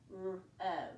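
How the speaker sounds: ordinary talk, nothing marked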